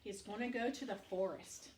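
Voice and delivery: usual speaking voice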